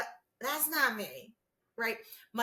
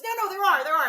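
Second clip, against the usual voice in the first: insisting; 9 semitones higher, 9 dB louder, faster than usual. Retold or imitated. Maddie insisting.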